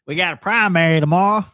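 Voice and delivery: loud, high mock Southern accent; playful Southern-accent mimicry; put-on Southern accent. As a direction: imitate her Southern twang, teasing. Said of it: "Now she's back in her s".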